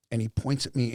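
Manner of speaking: ordinary talk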